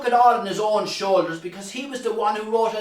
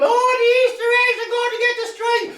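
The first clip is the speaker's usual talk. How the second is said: very high, tearful squeaky cry for help; high, panicky rabbit voice pleading from the river; frightened Easter Bunny; 14 semitones higher, 5 dB louder than usual. Acted. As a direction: squeal like a tiny animal stuck on a rock. Bunny fears for the eggs.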